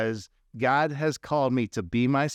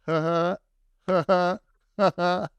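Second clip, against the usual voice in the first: mocking laugh; 3 semitones higher than usual. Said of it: "he starts going".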